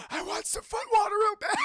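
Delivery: hammed-up parched cowboy begging; desperate plea. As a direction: beg like a man dying of thirst. Desperate Blondie's voice.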